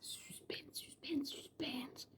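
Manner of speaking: breathy teasing whisper; hushed playful teaser; whispered suspense. Pretend. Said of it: "Bon, écoutez".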